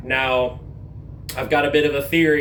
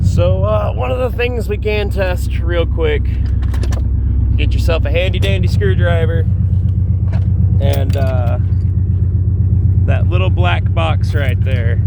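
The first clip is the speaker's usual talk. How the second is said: raised voice over engine; 2 semitones higher, 8 dB louder than usual. Real, not acted. Speaking over the running engine.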